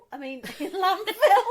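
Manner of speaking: laughing through the correction; giggling at her own slip. Real, not acted.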